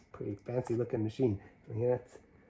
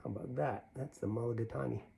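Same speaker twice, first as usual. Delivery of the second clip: usual speaking voice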